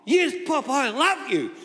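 put-on high child voice answering his grandfather; grandchild voice. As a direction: squeak it like a little boy. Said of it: "do you love Pop?".

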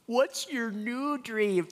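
high, drawn-out mock weepy wail; stretched-out, hammed-up crying voice; mock tearful. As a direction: imitate asking through tears, very slowly and high. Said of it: "we're crying. I was like".

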